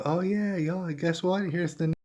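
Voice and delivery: high, chirpy mock reporter; mock news voice. Host mocking reporters who report late, high mock voice. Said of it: "and now the news is like".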